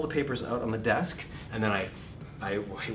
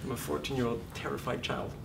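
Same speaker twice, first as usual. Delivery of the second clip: ordinary talk, nothing marked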